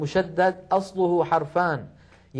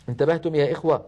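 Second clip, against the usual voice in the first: ordinary talk, nothing marked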